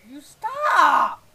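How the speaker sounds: high, drawn-out full shout; real furious yell; frustrated scream. Real, not acted. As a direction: scream it at the game. Screams at the lagging game.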